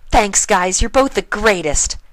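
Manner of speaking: high, loud happy thanks; gushing Pinkie. A narrator's reading. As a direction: squeeze them in a bear hug. Pinkie hugs guards.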